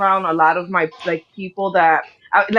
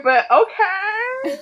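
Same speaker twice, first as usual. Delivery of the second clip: laughing, stretched out; 13 semitones higher, much slower than usual. Retold or imitated. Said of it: "I was literally like".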